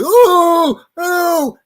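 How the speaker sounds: high, sung-out crowd ooh. Retold or imitated. Crowd watching the fight, high amazed ooh.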